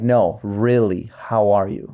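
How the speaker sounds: slow, sincere repeat of the question; sincere check-in. Shown as a demonstration. Leader asking people a second time, slow and sincere. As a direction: linger on it as if truly asking. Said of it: "when I ask the second time".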